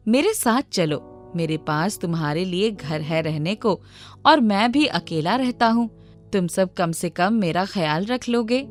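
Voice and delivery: usual speaking voice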